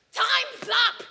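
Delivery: loud, high yell; shouted call. Acted. Kid calling time on scratching, loud high shout. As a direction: bark the time limit out.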